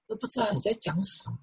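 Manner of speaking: hushed sigh rising to high, soft complaint; imitated doubter. Preacher voices people questioning tongues, doubting soft complaint. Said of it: "有些人他对方言提出质疑".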